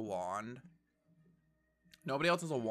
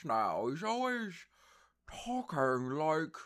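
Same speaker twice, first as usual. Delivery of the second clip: lightly mocked Ambrose voice, lips pushed forward; imitating Ambrose's voice; 4 semitones higher than usual. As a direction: parody his lip-pushed way of talking. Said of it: "he's talking like this now".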